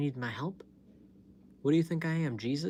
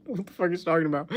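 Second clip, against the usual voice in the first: fast, incredulous aside, a bit louder; 4 semitones higher, 5 dB louder, faster than usual. Real, not acted. Baffled by Craig's spanking messages, fast incredulous blurt.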